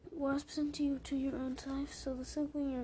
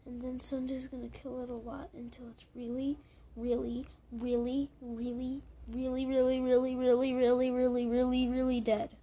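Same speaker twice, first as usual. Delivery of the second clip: building emphatic repetition; 8 dB louder than usual. Acted. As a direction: pile on the reallys, louder and louder until dead. Repeated really building loud.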